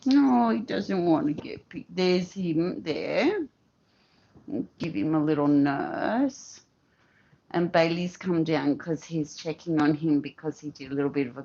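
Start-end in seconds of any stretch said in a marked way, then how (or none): none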